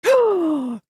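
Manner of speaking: sharp surprised gasp; excited gasp. Real, not acted. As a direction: gasp as it clicks. Realizes the answer.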